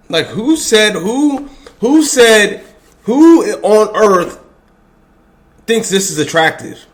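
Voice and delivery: high, loud, incredulous shout; outraged cry of who on earth; exasperated yelling. Real, not acted. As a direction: yell it in disbelief at the screen. Ranting at clip of woman.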